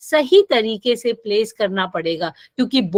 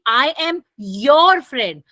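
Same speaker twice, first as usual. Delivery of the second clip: "your" punched louder; stress on YOUR; 3 dB louder, much slower than usual. Shown as a demonstration. Teacher showing her class the stress on "your". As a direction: land the weight on "your". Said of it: "तो मेरा stress किस पर है? Your पे है".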